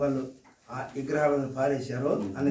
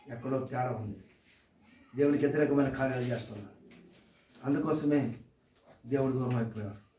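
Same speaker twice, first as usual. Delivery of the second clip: hushed heart-to-heart sermon; 3 semitones lower than usual. Acted. Preacher turns quiet and searching.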